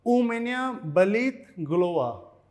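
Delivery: slow, careful syllable-by-syllable reading; teacher slowing down a Russian phrase; slow demo. Shown as a demonstration. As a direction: spell the phrase out slowly for learners. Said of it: "कैसे बोलेंगे इसको?".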